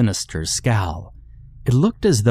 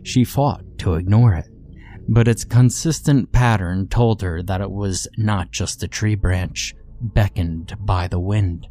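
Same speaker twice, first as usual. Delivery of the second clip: ordinary delivery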